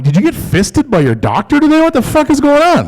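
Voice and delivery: high, loud shocked yell; outraged disbelief. Retold or imitated. Boyfriend shrieking at girlfriend's demo, high incredulous yell. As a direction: crank it up into a squeal of outrage. Comedian voicing outraged reply.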